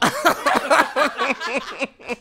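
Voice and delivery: helpless real laugh; cracking up. Real, not acted. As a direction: burst out laughing after a garbled try. Failed attempt at down up.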